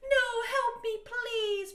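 high, loud mock plea for help; hammed-up terrified begging; loud, high fake-panic scream. A narrator's reading. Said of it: "Brittany screamed terrifiedly".

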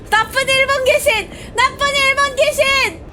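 high, loud indignant yelling; scolding outcry. Real, not acted. Player scolds the Japanese ghost after a scare, high indignant shouts. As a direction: yell at the ghost that scared you.

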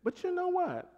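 high, soft voice of sudden realization; hushed, high-pitched change of heart; sudden turnaround. Acted. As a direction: drop the volume and lift the pitch right up. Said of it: "But then all of a sudden he says".

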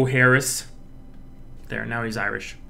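ordinary talk, nothing marked

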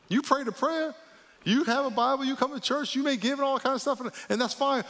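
high, insistent preacher's run; high-pitched rattled-off list. Real, not acted. Listing what churchgoers do.

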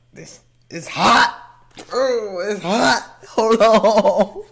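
high, loud, stretched-out exclamations; hyped reaction. Real, not acted. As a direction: react like the track just caught fire.

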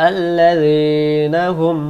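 slow melodic chant; Quran recitation. Acted. Teacher chanting next verse slowly. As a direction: draw out আল্লাযীনা.

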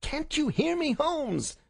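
very high, pressing shout; insistent waking call. Acted. Visitor shakes the sick man awake, insistent shout. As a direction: demand that the sleeper respond. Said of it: "insistent tone of one who awakens a sleeper".

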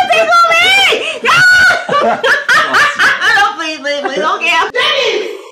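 high, loud shrieks breaking into laughter; tickled squealing. Acted. Girlfriend being tickled by her boyfriend, squealing with laughter. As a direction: squeal and beg helplessly through laughter.

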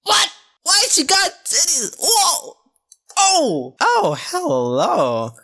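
stunned high squeals, half laughing; shocked shrieking. Real, not acted. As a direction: screech at the screen in shock. Shocked at grown-up Wendy.

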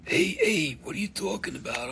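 lightly voiced trench coat hustler; mock shady dealer pitching on the sly; shady salesman. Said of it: "I'm gonna give you my trench coat voice".

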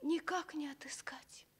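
unvoiced whisper, lost and fretful; a girl whispering her worry; hushed despair. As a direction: whisper it to yourself in dismay. Searching for lost ring.

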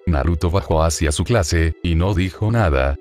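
plain reading voice

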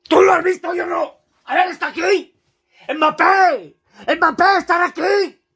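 high, loud calling out; desperate shouting. Real, not acted. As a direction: call out in exasperation. Shouting for Mbappé.

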